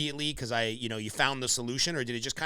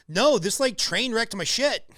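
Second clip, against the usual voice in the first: higher and louder, indignant; mock indignant retort; 8 semitones higher, 5 dB louder, slower than usual. New mom snapping back at people praising motherhood, indignant. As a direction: snap back at the cheerful crowd.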